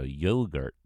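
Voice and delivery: "yogurt" as American YOH-gurt; American accent. Shown as a demonstration. Brit asked for best American accent, says "yogurt" American-style. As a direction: put on American long o and r in "yogurt". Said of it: "In your best American accent".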